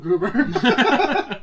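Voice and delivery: loud, high burst through laughter; laughing outburst. Real, not acted. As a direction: blurt it out, cracking up. Cracking up while naming a film.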